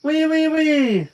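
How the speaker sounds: high, slow drawn-out mock squeal; piggy squeal. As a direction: stretch each wee into a squeal. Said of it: "She fake sneezed and said".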